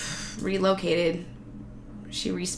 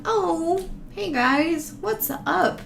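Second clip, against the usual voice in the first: high, cheery hello to a daddy long legs; friendly greeting to spiders; 7 semitones higher, much slower than usual. Retold or imitated. To daddy long legs spiders, high cheery hello. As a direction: greet the spider like an old pal. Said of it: "I'm like".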